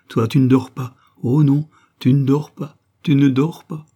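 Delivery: high, loud, insistent repetition; agitated plea, pitch climbing; mounting distress. A narrator's reading. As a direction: grow frantic with each repeat. Madeleine's rising agitation before breakdown.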